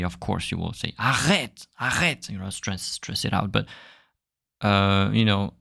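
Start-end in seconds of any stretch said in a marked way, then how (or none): 1.0-2.3 mock angry "arrête" with a stressed throat R. Shown as a demonstration. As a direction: snap "arrête" as if annoyed, stressing the R. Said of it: "If you're angry".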